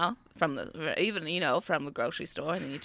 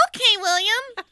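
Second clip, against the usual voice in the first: mocking mom voice; 12 semitones higher than usual. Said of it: "She was like".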